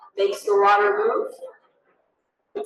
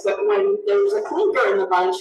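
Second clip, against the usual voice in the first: ordinary talk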